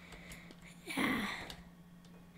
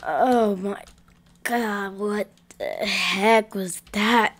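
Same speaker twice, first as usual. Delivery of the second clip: usual speaking voice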